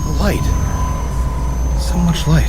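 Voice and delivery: low, hushed wonder; breathless amazement at the light; awestruck. Acted. Man seeing the creature for the first time, awestruck.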